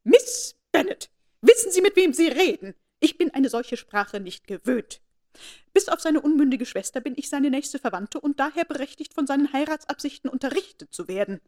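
put-on haughty aunt affronted by the girl's tone. A narrator's reading. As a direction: put her in her place with indignation. Lady Catherine affronted.

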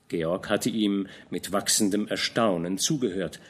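usual speaking voice

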